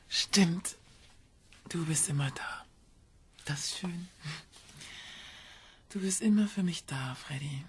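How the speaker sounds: high, airy, affectionate voice; tender half-whisper. Acted. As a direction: murmur it fondly to your child. Parent speaks tenderly to son.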